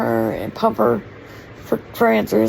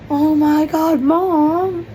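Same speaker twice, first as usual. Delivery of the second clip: higher, louder, excited "Oh my God, Mom"; excited daughter; 6 semitones higher, 6 dB louder, much slower than usual. Retold or imitated. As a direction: gasp it out like a thrilled daughter getting a surprise. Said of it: "she's gonna be like".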